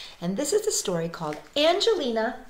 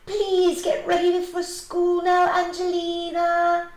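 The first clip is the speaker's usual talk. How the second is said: high, loud, slow singsong call; 9 semitones higher, 5 dB louder, slower than usual. A narrator's reading. Mother nagging her daughter to get ready for school, calling out. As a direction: draw it out so it carries upstairs. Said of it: "always calling up to her".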